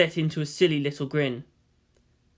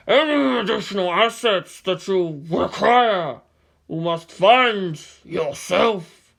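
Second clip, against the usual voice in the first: slow, much louder commanding voice; stern elder; 4 semitones higher, 5 dB louder, slower than usual. Acted. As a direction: lay down the order heavily. SEELE member voice.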